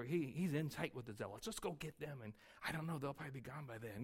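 hushed, resentful grumbling to a friend; a bystander quietly griping about the Roman troops; muttered grumbling. Retold or imitated. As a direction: mutter your complaint low so the soldiers don't hear. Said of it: "you're sitting over there going".

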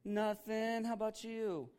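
high, put-on sweet phone voice; mock-cheery wife on the phone; sudden sweet switch. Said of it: "you ever see your wives do that".

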